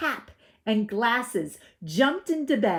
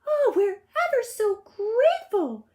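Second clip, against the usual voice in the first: put-on 1 grateful little girl; 6 dB louder than usual. A narrator's reading.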